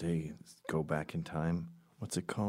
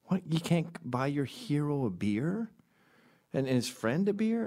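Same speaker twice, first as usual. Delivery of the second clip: high teasing needle; mock challenge; 8 semitones higher than usual. Comedian goads a fan into buying beers, high and teasing. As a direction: egg the fan on to buy beers. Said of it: "and I'd say".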